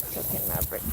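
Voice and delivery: soft reassuring whisper. Real, not acted. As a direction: whisper to calm him.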